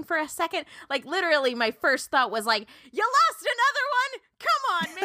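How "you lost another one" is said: high, slow incredulous question; hammed-up astonishment, pitched way up; mock disbelief. Reacting to the babysitter losing a kid, high mock disbelief. Acts out first thought on babysitter.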